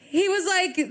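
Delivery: ordinary delivery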